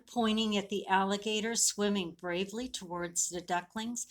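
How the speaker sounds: ordinary delivery, nothing marked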